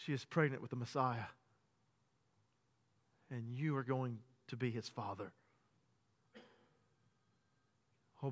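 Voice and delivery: hushed tender preaching. Acted. Preacher marvelling to the church at Joseph, hushed and tender. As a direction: draw the congregation close and marvel.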